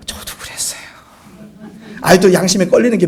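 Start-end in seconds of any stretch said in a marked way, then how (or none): 0.1-0.8 whispered confession. Real, not acted. Preacher confessing to the congregation in a whisper. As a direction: breathe it out with no voice.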